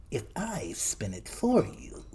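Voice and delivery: slow, wheedling creature voice; little man voice. A narrator's reading. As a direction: bargain slowly like a crafty gnome. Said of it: "said the little man".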